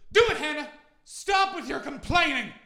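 high, loud, hammed-up bossy yell; man's loud demand. [name] ordering Hannah on the platform, mock bossy yell. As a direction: bark at her to stop moaning. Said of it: "And she goes".

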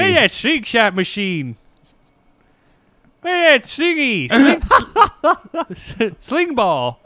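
imitating a baffled old man, laughing. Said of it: "I sounded like an old man trying to describe a new technology".

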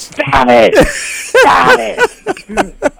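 very high, laughing mock scream. Quoting Arsenio at the little gun, laughing high scream. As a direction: shriek it through laughter. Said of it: "Arsenio's screaming at him".

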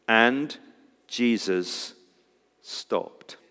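low, soft, word by word with pauses; hushed, dramatic scripture reading; hushed reverence. Real, not acted. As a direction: let each word land quietly. Dramatic reading of three telling words.

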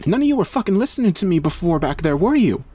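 high, quick, scornful outburst. A narrator's reading. Heather scolds the others, high and scornful. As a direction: throw it out fast and high. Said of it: "she says with a laugh".

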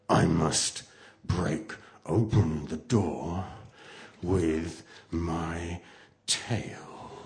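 slow, ominous, broken by pauses; menacing new mother. A narrator's reading. New mother outside the door, menacing. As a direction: let each phrase fall with menace.

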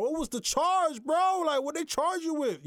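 almost yelling in disbelief; shocked demand. Retold or imitated. Retold shocked question to a friend.

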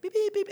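high piercing mock beeps; car beeping. Pastor voicing the car's nagging alarm, high beeps. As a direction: beep like the car won't quit.

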